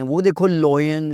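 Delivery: mother showing off English, लॉयन as "loyen"; drawn-out लॉयन with an "oy" sound; imitated mispronunciation. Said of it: "it's not lion". (माँ की नकल, खींचकर "लॉयन")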